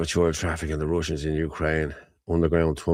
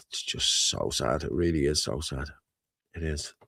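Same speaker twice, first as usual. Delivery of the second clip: sad, sighing; 3 semitones lower, 7 dB quieter than usual. Real, not acted. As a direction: let it fade out on a heavy sigh. Grieving over a dead child.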